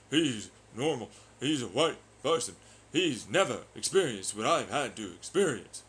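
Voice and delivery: a loud, hammed-up whiny complaint; mocking whiner. Streamer imitating a commenter, in loud mocking whine.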